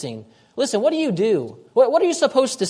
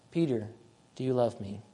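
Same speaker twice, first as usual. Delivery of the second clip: hushed and low, much softer, almost under the breath; tender question; 9 semitones lower, 11 dB quieter than usual. Acted. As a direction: let the question land softly. Jesus asks Peter again.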